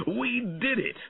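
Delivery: high crowing laugh, then gleeful cheer; high-pitched gleeful boast; laughing triumphantly. A narrator's reading. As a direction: laugh and crow over the win. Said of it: "he laughed loudly".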